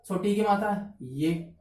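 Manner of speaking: ordinary talk, nothing marked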